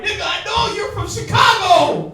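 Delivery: high, loud, laughing yell; exasperated shout. Retold or imitated. Student fed up with the fake-French teacher, yelling and laughing.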